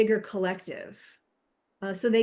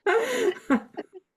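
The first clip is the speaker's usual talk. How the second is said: high laugh; 11 semitones higher, 4 dB louder than usual. Acted. Laughs inside the accent bit.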